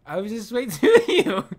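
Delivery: laughing. Real, not acted. Laughing mid-sentence during game.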